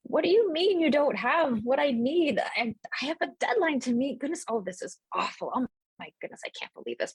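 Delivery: a harried elder berating a merchant, trailing off; flustered outburst. Acted. Sky elf berating a merchant, high flustered rant fading to a mutter. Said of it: "you would overhear her going like".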